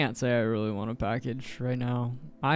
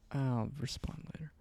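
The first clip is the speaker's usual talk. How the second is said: whispered aside; 9 dB quieter than usual. Real, not acted. Muttering aside to self.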